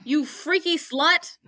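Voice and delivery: high, appalled retelling; imitated indignant accusation; mock disgusted outrage. Dean appalled at Max.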